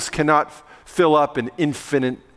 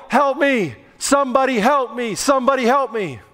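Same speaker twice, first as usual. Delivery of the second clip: higher, pleading call for help from the shaft; pretend cry for help; 6 semitones higher than usual. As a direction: call out for help as if hanging in the dark. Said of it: "And you begin to he-, yell".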